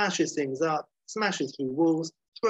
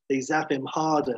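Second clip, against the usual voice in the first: ordinary talk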